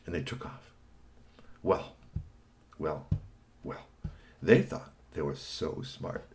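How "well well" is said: ordinary delivery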